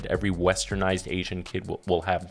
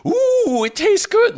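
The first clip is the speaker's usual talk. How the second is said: very high, loud fake gushing; hammed-up imitation of forced delight; wife's mock enthusiasm; 7 dB louder, slower than usual. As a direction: gush sarcastically in a squeaky falsetto. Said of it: "Literally, she'll do that".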